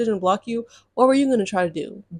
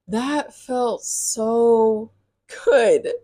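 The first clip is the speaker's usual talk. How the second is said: drawn out; much slower than usual. Real, not acted. Savoring the satisfaction.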